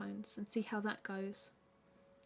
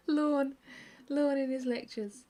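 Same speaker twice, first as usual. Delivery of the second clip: amused repeat; 5 semitones higher, 6 dB louder, slower than usual. Real, not acted. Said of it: "Said that wrong".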